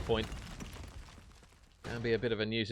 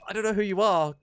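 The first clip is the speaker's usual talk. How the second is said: higher, louder baffled voice; puzzled reply; 10 semitones higher, 7 dB louder than usual. Retold or imitated. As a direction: act lost at a stranger's greeting. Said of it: "I'm like".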